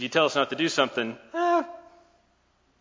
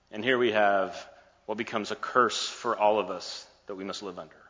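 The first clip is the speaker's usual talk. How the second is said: ordinary talk, nothing marked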